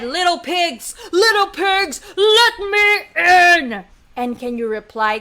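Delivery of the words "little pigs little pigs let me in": rising demand, stretched at the end; big bad wolf. Wolf at the wooden house, loud drawn-out demand. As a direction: get louder and drag out the ending. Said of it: "And he said".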